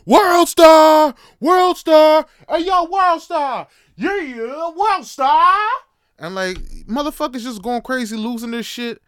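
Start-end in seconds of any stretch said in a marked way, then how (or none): 0.1-5.6 much higher, much louder hype yelling; yelling "World Star". Retold or imitated. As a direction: scream it like a crowd egging on a fight. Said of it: "Motherfuckers yelling in the background".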